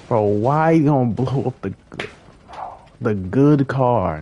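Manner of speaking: real frustrated yell; angry outburst. Real, not acted. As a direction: yell at him for wrecking it. Angry at player blowing up car.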